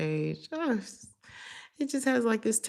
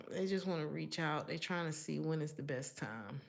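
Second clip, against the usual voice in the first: much softer, hushed voice; 3 semitones lower, 7 dB quieter than usual. Real, not acted. Describing the watcher waiting to approach, hushed. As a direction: drop to a hush like someone lurking. Describing lurking person softly.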